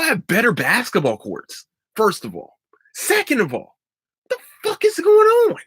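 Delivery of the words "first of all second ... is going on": exasperated outburst. Real, not acted. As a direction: blurt it out in disbelief. Ranting about horse competition.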